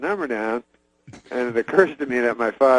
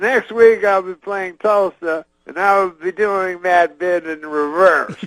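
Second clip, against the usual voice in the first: high, slow, drawn-out stage announcement; Bill Cosby impression; 7 semitones higher, 4 dB louder, slower than usual. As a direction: announce it slowly and high to a roused crowd. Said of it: "at the end he goes".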